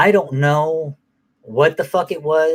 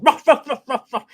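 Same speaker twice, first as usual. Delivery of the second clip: high yappy imitated barks; dog barking; 12 semitones higher than usual. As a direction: bark like an excited little dog. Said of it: "Watson, he's going".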